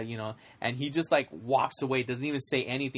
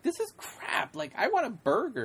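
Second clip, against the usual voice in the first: put-on much higher, half-whispered, whiny kid; disgusted kid; 10 semitones higher than usual. Man-child spits out the caviar and wants a burger, high whiny whisper. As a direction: scrunch up like a child hating caviar.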